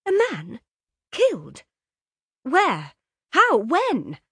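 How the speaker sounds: high, loud rapid questions; shocked. A narrator's reading.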